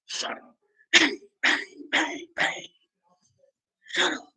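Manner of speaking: whispered shut up and bangs; mock whispered shut up. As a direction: hiss it like a threat. Girl voiced shooting him.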